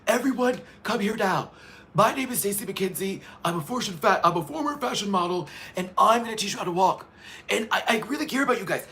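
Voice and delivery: high, bright runway coach voice; Stacy impression. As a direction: play an upbeat runway coach. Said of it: "Stacy's like".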